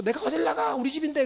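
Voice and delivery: mock defiant child clinging to the door. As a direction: refuse to leave, like a cheeky son. Defiant child answering angry mother.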